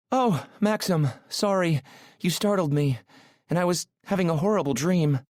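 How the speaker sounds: put-on high, melodious woman's voice with an accent; Aurora. A narrator's reading. Startled woman apologising to her driver, melodious and accented. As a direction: apologise in a high, lilting accented voice, calming after a fright. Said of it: "Her accent is beautiful, melodious".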